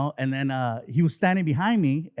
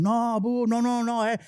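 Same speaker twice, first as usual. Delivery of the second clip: high-pitched imitation of a booing crowd; mock crowd wailing no; protesting crowd; 10 semitones higher, slower than usual. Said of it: "the crowd started going".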